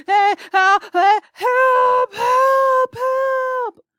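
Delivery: high, loud yelled cries for help; ghastly screams. Acted. Voices over the loudspeaker being attacked, ghastly screaming yells. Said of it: "gave out a series of mostly ghastly screams and yells".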